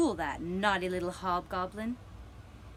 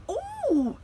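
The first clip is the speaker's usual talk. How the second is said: sharp, high gasp of fright; startled cry; 5 dB louder than usual. A narrator's reading. Little hobgoblin startled by a glowing face, crying out high. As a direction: jump at the sight and cry out. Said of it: "he cried".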